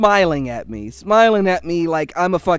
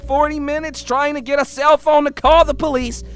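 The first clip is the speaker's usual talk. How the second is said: high-pitched, mounting exasperation; outraged, strained complaint; worked-up rant; 5 semitones higher than usual. Real, not acted. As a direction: rant yourself to the end of your rope. Rant peaks before taking a breather.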